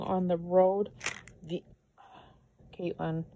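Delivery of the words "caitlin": usual speaking voice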